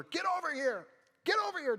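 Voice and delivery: high, loud yell across the room; mock yell across the room. Mom yelling at her kid across the sanctuary, high loud yell. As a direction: call the kid back like a fed-up mom. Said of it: "she yells over".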